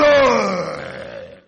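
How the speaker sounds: put-on a loud kid's "Oh!" to frighten; mock scary kid noise. Preacher mimicking kids scaring people, mock loud "Oh!". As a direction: belt "Oh!" like a child playing monster. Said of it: "They do".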